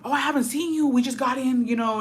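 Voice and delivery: high, breezy feigned surprise; fake innocent. Uncle dodging dad's question, acting innocently surprised. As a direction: play dumb, all innocence. Uncle playing dumb at wedding.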